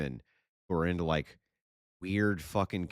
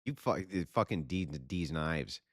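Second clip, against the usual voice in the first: normal talking voice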